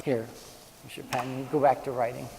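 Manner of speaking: soft, low aside to a neighbour; quiet aside. Real, not acted. Handing David back his pen, a soft aside.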